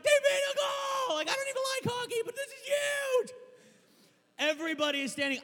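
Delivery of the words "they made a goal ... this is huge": excited shouting. Retold or imitated. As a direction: shout to your friend over the crowd. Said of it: "I got excited".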